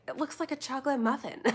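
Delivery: retold first impression, about to crack up; amused reaction. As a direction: say it fast and high, laughing.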